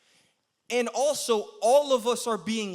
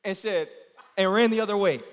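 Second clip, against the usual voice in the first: usual speaking voice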